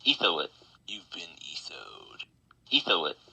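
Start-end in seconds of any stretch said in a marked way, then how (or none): 0.9-2.2 soft breathy whisper, slower. Acted. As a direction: breathe the line out low. Whispered repeat of catchphrase.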